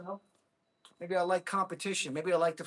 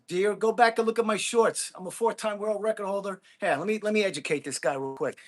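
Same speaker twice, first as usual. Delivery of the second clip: normal talking voice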